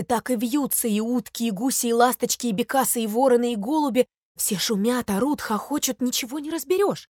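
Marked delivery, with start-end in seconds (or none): none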